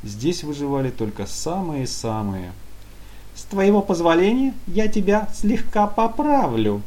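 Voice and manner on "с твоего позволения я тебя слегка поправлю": high, loud, lightly taunting minion voice; mocking. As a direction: needle him with mock courtesy. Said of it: "с лёгкой издёвкой".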